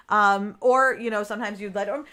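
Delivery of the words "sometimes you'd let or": normal talking voice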